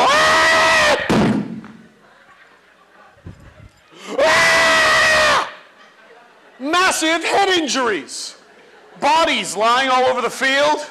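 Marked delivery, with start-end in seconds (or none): 0.1-5.5 put-on high, loud kid's scream; kids screaming. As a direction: shriek like a kid losing it. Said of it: "they go".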